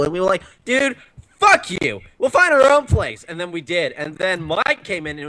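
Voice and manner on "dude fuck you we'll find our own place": mock angry. Friends telling guy off.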